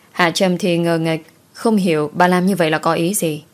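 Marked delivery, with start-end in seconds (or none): none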